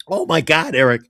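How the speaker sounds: gasping out words between laughing fits; helpless with laughter, spluttering and coughing; laughing hard. Real, not acted. Begging co-host for mercy, laughing hard. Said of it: "I'm laughing so hard".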